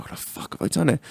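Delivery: breathy whispered dismay; whispered panic. Retold or imitated. Realising his solenoid mistake, a whispered panic. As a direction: hiss it to yourself in horror. Retold shock at own mistake.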